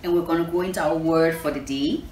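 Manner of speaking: ordinary delivery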